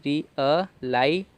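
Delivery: री अ लाई spelled out slowly in separate syllables. Shown as a demonstration. Teacher sounding out री अ लाई for students. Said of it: "तो री अ".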